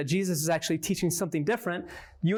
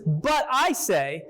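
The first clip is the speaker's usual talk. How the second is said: drawn-out "but I say", weight on "I"; slow right down and lean on "I"; emphatic stress; 3 semitones higher, 3 dB louder, much slower than usual. Shown as a demonstration. Teacher repeating the verse for emphasis, slow stress on "I". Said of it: "the emphasis".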